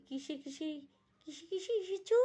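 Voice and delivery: put-on high, excited girly question; eager girlfriends. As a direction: ask like a thrilled girlfriend. (উৎসুক মেয়েলি প্রশ্ন)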